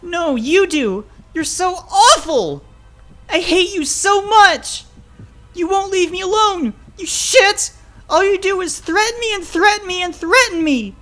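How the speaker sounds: very high, loud, anguished outburst; hysterical screaming. Acted. Child pouring out hurt at father, hysterical scream. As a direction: scream the accusations, building to a wail.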